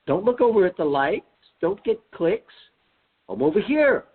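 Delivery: high sing-song voice pulling eyes back; a teacher saying look at me, not there; teacher-style call. Retold or imitated. Teacher-like Jesus calling attention.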